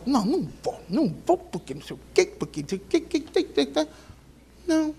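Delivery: hushed, jumpy grumble of refusal; mock-sulky muttered excuses trailing into nonsense; mock grumbling. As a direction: mimic a wife griping under her breath. Acting out wife refusing to go.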